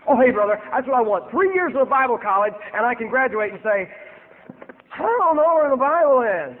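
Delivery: mock the answer with scorn; hammed-up scoffing voice; sarcastic mockery. Mocking Gary's answer.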